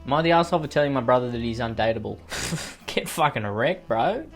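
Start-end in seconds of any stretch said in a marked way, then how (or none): none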